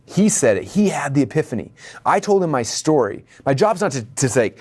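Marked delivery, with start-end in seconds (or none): none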